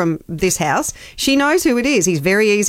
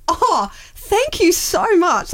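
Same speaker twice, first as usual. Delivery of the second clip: delighted mechanic; 11 semitones higher, much slower than usual. Retold or imitated. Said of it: "He went".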